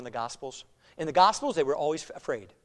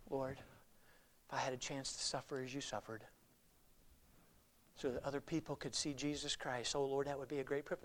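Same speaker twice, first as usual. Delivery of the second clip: hushed, solemn prayer; 12 dB quieter than usual. Retold or imitated. Well-known preacher telling of his prayer to suffer, hushed solemnity. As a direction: let it sink to near a murmur, with long pauses.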